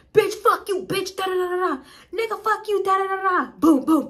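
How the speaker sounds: high, loud mock screaming argument; shrill-free squeal of a fight, hammed up; mock fighting couple. Neighbours fighting in the house.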